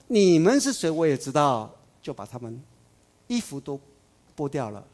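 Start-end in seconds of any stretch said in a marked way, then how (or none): 0.1-0.9 loud, high demanding shout; demon's challenge. Retold or imitated. Demon shouting at the exorcists, high and loud. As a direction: bark it like a defiant demon. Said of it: "他说".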